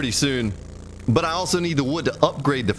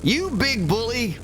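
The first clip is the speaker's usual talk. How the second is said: higher, slow, hammed-up whine; mock whiny complaint; 10 semitones higher, slower than usual. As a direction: whine "You big bully!" like a hurt child, high and slow. Repeats taunt at game animal.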